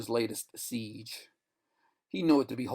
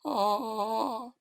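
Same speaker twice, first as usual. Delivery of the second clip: eerie crying tone; 10 semitones higher than usual. Acted. Fading voices crying, eerie sung tone. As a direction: moan like a far voice. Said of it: "Voices faded".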